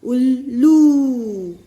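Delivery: slow teacher demo; slow stretched reading. Shown as a demonstration. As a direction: stretch उल्लू slowly. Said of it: "उच्चारण होगा".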